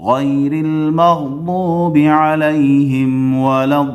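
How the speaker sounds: melodic full chant of Surah Fatiha; Quran recitation. Shown as a demonstration. Teacher recites the whole surah as a model. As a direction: recite slowly and tunefully.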